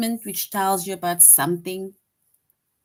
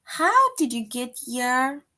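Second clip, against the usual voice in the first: slow, higher question read with a rising lilt; asking question tune; 6 semitones higher, 4 dB louder, slower than usual. Shown as a demonstration. Teacher reading the question option to students, slow and lilting. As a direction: ask "How did you get here?" slowly, curious. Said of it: "Option two is".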